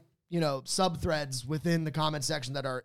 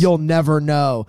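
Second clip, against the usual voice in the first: loud teasing jab; loud playful taunt; loud tease; 12 dB louder than usual. Real, not acted. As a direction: tease him loudly. Teasing reply.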